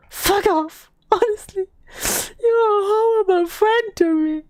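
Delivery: high-pitched mock outrage, not shouted; performed wounded anger; acted indignation. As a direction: read it as a huffy, dramatic brush-off. Reading out texts that insult friend.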